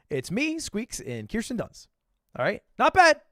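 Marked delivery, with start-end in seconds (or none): 2.8-3.2 loud, high "Not bad"; delighted about the photo; excited praise. Real, not acted.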